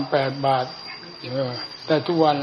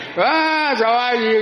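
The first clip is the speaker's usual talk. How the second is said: mock loud greeting; 8 semitones higher, 3 dB louder than usual. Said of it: "เสียงลั่นขึ้น".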